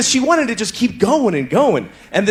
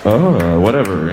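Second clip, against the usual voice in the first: low, lazy half-moan; bored moan; 11 semitones lower, slower than usual. Retold or imitated. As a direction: moan without caring at all. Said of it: "holding pattern bullshit moan".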